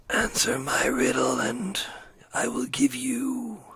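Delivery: slow eerie whisper of a riddler; whispering sphinx. Acted. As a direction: breathe the riddle out slowly, menacing. DM voices masked skeleton.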